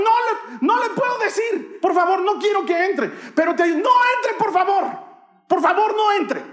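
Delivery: very high, loud pleading yell; hammed-up hysterical begging of a mortified child; panicked shouting. Boy begging his teacher not to enter, panicked shouting. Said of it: "empecé a gritarle".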